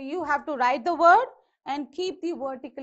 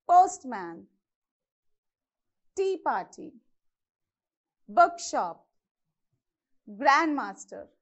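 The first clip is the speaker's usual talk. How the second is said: postman, tea party, bookshop, grandmaster, each stressed on its first part; POSTman, TEA party, BOOKshop, GRANDmaster, paused between; first-syllable stress; slower than usual. Shown as a demonstration. Teacher demonstrating compound words, first-syllable stress. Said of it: "this is how you have to pronounce correctly".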